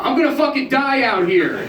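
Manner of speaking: put-on high, loud histrionic drunk cry; hammed-up drunken despair; drunk wail. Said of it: "shaking one of the managers going".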